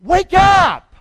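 a preacher jolting the church awake; shouted. Acted. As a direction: bellow it at the congregation. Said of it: "You could be him".

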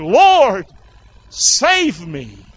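loud high cry on Lord, falling away. Acted. Sinking Peter calls to Jesus, crying out. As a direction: cry out for rescue, drawn out. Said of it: "he cried, saying".

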